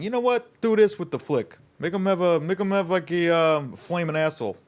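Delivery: high, loud blustering studio boss; Italian Peter Griffin. Retold or imitated. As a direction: bark orders like a brash exec. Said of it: "That sounded almost Peter Griffin".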